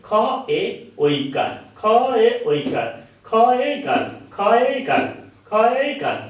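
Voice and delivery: teacher repeating খ এ কার; spelling drill. Shown as a demonstration. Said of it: "একটু বোঝাতে হবে এইভাবে".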